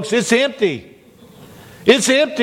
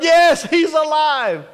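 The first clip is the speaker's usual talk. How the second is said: high, drawn-out joyful yes; emphatic confirmation; 8 semitones higher, slower than usual. Retold or imitated. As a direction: confirm it exultantly, stretching the words. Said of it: "no doubt Peter confirmed".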